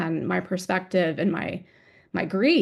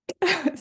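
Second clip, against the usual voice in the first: tearful embarrassed laugh; a high laugh through tears; teary laugh; 10 semitones higher than usual. Real, not acted.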